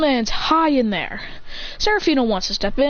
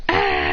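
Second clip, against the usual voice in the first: long sustained scream of gathering power. Acted. Fighter with aura swirling around him, power-up scream. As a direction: hold the scream as the power builds.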